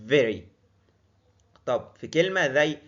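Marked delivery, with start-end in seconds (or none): 0.1-0.4 very with top teeth on lower lip, buzzing v; teacher demo of v. Shown as a demonstration. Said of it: "سناني بتيجي على شفتي اللي تحت".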